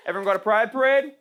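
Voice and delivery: ordinary talk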